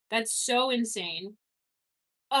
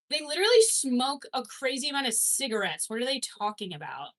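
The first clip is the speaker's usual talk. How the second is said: ordinary talk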